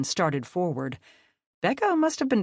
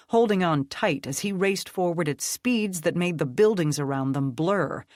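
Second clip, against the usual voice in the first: ordinary delivery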